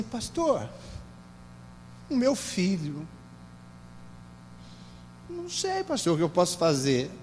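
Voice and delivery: quiet, halting plea for advice; hushed, helpless retold plea; distressed mother. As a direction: imitate a worried mother, softly, with long pauses. Retells mother's distressed phone call.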